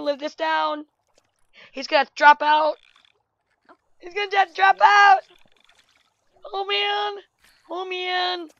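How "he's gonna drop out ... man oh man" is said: panicked. Real, not acted. Panicking over game character.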